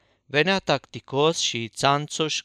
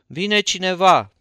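raised, louder narrated call; 5 semitones higher, 6 dB louder than usual. A narrator's reading. Tușa Sally at the window announces a visitor, calling out. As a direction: call out excitedly, voice lifted higher. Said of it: "strigă".

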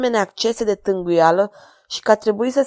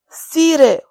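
sobbing; 8 semitones higher, 6 dB louder than usual. Acted. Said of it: "hohoti Tribulet cu o voce slabă".

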